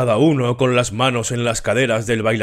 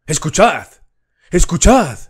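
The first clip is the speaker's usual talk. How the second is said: high, loud blasting command; a sudden booming call to listen; trumpeting call; 6 semitones higher, 5 dB louder than usual. A narrator's reading. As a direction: trumpet it out to startle the room. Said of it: "la voz trompeteó".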